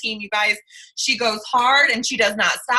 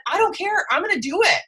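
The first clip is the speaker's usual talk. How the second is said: higher, resolute retelling of her vow; determined quote; 6 semitones higher than usual. Retold or imitated. Host retells teammate's last-day vow, determined. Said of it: "she looked at that number and she said".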